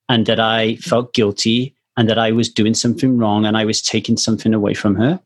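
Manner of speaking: ordinary talk, nothing marked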